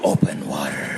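very low movie-trailer announcer; trailer title voice. Acted. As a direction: drop right down and intone the film title. Trailer title after mock preview.

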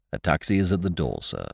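calm, deferential butler reply; Jeeves. A narrator's reading. Valet calmly advising his master to leave London. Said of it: "Jeeves replies calmly".

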